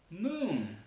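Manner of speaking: "moon" with lengthened, humming M and N; drawn-out English "moon"; long M and N. Shown as a demonstration. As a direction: hold the consonants of "moon" English-style. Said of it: "son larguísimas".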